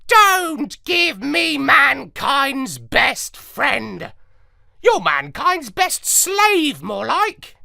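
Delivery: high, loud, slow scornful parrot voice; retorting parrot. A narrator's reading. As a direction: hit back at him like a bitter parrot. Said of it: "and he retorted".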